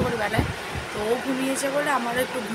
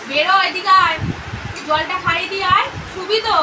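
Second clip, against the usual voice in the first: calling out; 6 semitones higher, 7 dB louder than usual. Real, not acted. Mother calling her daughter to drink water before a nap, loud.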